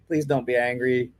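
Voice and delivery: usual speaking voice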